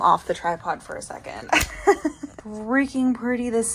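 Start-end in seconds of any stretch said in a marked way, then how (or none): 1.5-2.4 airy, delighted laugh; breathy laugh. Real, not acted. Thrilled at binder spine.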